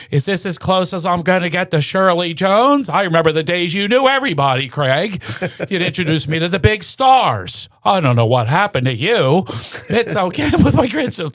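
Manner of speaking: high, whiny mom voice, quick; complaining mother. Retold or imitated. Son imitating mother scolding him in the garage. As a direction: nag your son about the old days. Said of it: "And she go".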